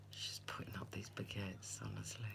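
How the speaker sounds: whispering, half laughing; amused whisper. Real, not acted. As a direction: tell it softly behind a hand. Whispered aside about Kimberly.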